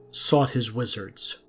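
normal talking voice

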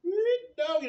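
sudden high, loud yelp; excited yelp. Real, not acted. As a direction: blurt it out, stung by the heat. Reacting to chip burn.

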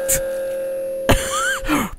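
a very high shrieking laugh; shocked high laugh. Real, not acted. Streamer squealing with laughter after a jump scare.

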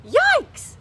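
put-on a startled girl's shriek; high piercing yikes. A narrator's reading. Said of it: "she shrieked".

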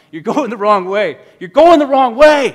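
high, very loud sideline yell, building. Retold or imitated. Players yelling at Marshall running the wrong way, building shout. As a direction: scream it down the sideline. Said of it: "We were yelling".

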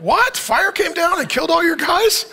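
high-pitched feigned astonishment; mock surprise. Preacher playing Elijah told what he already knows, mock surprise. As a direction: act amazed, as if it's news to you. Mocking Elijah hearing old news.

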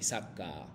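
"Saca" muy grave y suave, como adivinanza; "Saca" de prueba. Shown as a demonstration. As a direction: di "Saca" bajito para que adivinen. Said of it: "qué es lo que estoy diciendo aquí". (pregunta a los alumnos, "Saca" muy suave)

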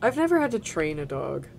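ordinary talk

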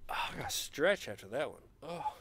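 breathless whispered aside; spent whisper. Real, not acted. Fan drained after screaming at the game, whispered. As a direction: whisper it, worn out from yelling.

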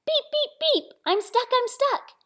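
high-pitched storybook distress; little truck crying out. A narrator's reading. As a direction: squeal it like a small stuck truck. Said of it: "cried Little Blue Truck".

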